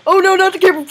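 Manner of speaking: loud, high panicked cry; mock alarm. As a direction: shriek it in hammed-up horror. Acted alarm in game.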